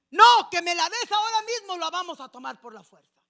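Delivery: high, loud bullying shout; angry threat. Retold or imitated. Eli's sons shouting at the people to hand it over. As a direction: bark the demand at them.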